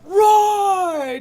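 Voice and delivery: high, loud, stretched-out shout on roar. Acted. Game master describing the crowd going wild, roaring the word.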